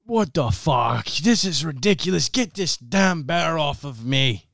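high-pitched, indignant struggling man; fed-up victim pinned under a bear; exasperated character. Acted. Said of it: "And he's like".

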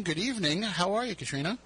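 ordinary talk

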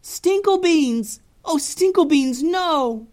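Bussy crying for her cat left behind; anguished cry. Acted. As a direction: cry out in dismay. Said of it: "they heard Bussy cry out".

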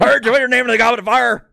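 high, fast, shouting mock headmaster; furious Dumbledore. Friend mocking the film line, hammed-up shouting Dumbledore. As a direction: scream it like the movie meme. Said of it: "It's just like the movie".

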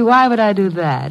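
ordinary talk, nothing marked

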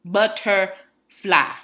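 "Butterfly" broken into slow, loud syllables; syllable-by-syllable emphasis. Retold or imitated. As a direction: hammer out "Butterfly" very slowly, a pause between syllables. Spelling it out for worker.

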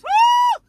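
a high, loud, ecstatic woo; joyful whoop. Real, not acted. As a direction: let the spirit burst out in a whoop. Preacher whooping mid-sermon.

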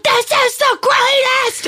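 high, thrilled scream down the phone; screaming with joy. Retold or imitated. Best friend screaming over the phone, thrilled shout. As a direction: shriek it like the best news ever. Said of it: "she started screaming on the phone".

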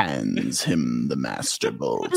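deep, ponderous dramatic narrator voice; Patrick Page impression. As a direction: drag it out in a huge deep bass. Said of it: "talk like this in the Patrick Page voice".

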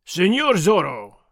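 slow, loud soldier's shout; loud call. Acted. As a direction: bellow the name through the door. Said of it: "Sergeant Gonzalez cried".

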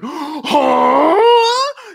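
high, loud startled huh; shocked gasp. Retold or imitated. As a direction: yelp it out in sudden disbelief.

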